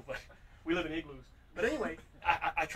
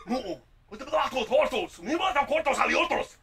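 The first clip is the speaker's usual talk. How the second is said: loud, high-pitched fake-Spanish rant; a different dude raging in mangled Spanish; mock Spanish accent; 8 semitones higher, 9 dB louder, much slower than usual. Imitating the man answering his truck phone, mock Spanish accent. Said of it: "he's like a different dude".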